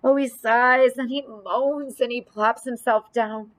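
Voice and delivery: ordinary delivery, nothing marked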